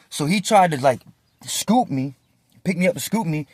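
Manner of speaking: ordinary delivery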